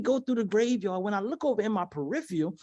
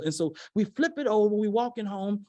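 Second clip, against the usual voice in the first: normal talking voice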